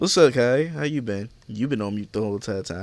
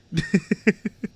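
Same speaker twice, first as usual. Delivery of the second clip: high burst of laughter; 11 semitones higher, 5 dB louder than usual. Real, not acted. Laughing at friend on call.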